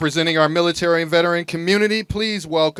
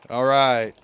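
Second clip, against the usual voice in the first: usual speaking voice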